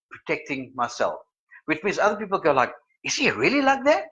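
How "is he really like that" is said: high, incredulous question; mock disbelief. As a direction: ask it as a gossiping onlooker in disbelief. Mimics other people's disbelieving question.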